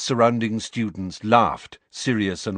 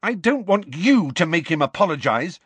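high, angry protest; shouted; 8 semitones higher than usual. A narrator's reading. Lily rounds on James, shouting. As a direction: snap back in a raised voice. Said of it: "Lily shouted".

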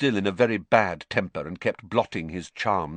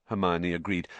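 usual speaking voice

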